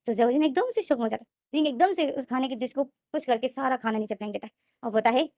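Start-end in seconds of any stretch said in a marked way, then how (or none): none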